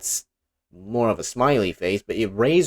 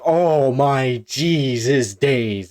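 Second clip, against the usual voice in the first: put-on a touch of a frightened child, slow at first; scared kid; 4 semitones higher, 5 dB louder, much slower than usual. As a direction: gasp it out like a spooked little boy. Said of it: "He's like".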